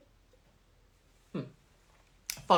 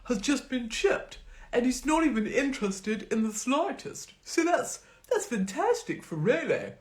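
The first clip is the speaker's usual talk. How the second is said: higher, chirpy posh voice; a hint of a gushing posh owner; lightly voiced posh man; 9 semitones higher, 4 dB louder than usual. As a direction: play the cheerful owner. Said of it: "And he goes".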